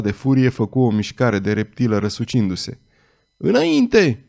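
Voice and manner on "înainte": a high, stretched-out bellow; roaring. A narrator's reading. The pinned thug roars at his men to attack. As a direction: roar the order at the gang. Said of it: "răgni el".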